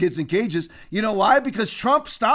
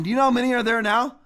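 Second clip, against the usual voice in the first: normal talking voice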